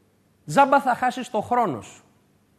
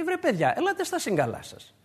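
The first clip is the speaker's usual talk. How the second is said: softer pleading appeal to the rebels; gentle exhortation; 2 semitones higher, 8 dB quieter than usual. Retold or imitated. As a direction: coax them back, softer and kind. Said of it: "Άρχισε λοιπόν να τους δίνει μία προτροπή".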